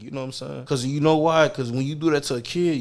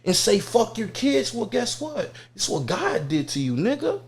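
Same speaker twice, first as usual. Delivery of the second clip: tough scolding; 7 semitones higher than usual. Acted. Acted confrontation scolding the man.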